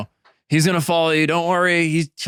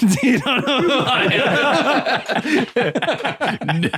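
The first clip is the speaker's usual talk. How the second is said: laughing; 9 semitones higher, much slower than usual. Acted. Laughing boast as character.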